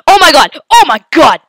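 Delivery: usual speaking voice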